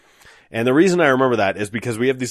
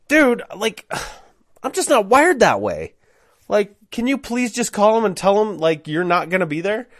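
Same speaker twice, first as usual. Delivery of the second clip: high-pitched, frustrated appeal; exasperated pleading; 10 semitones higher than usual. Retold or imitated. Narrator begging friend to call them, exasperated.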